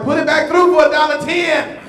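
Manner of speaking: laughing mock outrage; mock outraged demand. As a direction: bark it back like an indignant customer. Retold own angry counter complaint.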